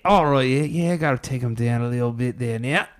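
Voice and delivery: Australian animal wrestler talking himself through it; Australian accent. Shown as a demonstration. Friend redoing his animal-wrestling bit, Australian accent. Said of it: "Australian one".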